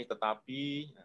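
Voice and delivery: "tetapi" dropped soft, trailing off as if held back. Shown as a demonstration. Coach showing how a client trails off on tetapi. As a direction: let "tetapi" fade away softly. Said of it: "Terus di belakang".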